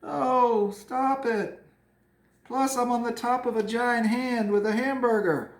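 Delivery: high, loud, slow horrified lady voice; shocked woman. Pretend. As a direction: ham up a lady appalled by the dog. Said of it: "She's like".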